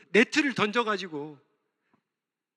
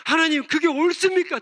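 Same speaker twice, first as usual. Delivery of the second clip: higher, louder protest to God; 6 semitones higher, 4 dB louder than usual. Acted. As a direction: argue with heaven. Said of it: "따졌던 하박국이". (하나님께 항의하는 하박국, 높고 크게)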